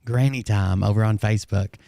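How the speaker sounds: ordinary talk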